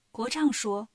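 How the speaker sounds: ordinary delivery, nothing marked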